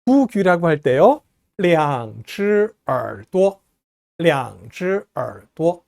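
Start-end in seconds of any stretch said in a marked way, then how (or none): 1.6-3.6 량즈耳朵 very slow, syllable by syllable; pronunciation model. Shown as a demonstration. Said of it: "이렇게 발음합니다". 4.2-5.8 량즈耳朵 in careful, separated Mandarin syllables; pronunciation model. Shown as a demonstration. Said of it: "이렇게 발음합니다".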